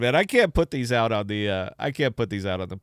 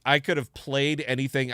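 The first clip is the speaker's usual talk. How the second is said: ordinary delivery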